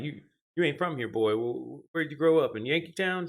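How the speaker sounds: exaggerated Southern heckler impression; mimicked Southerner. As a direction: imitate a suspicious Southerner, pitched well up. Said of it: "when I'm in the South, they're like".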